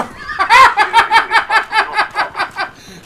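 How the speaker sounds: hearty cackle; big high laugh. Real, not acted. Laughing at his own RPG play, loud and high.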